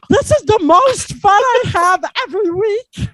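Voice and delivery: hammed-up, high and loud Russian accent; cartoonish Russian crossed with Stitch. Classmate Olga gushing about acting class, exaggerated Russian accent. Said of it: "that was a Russian accent".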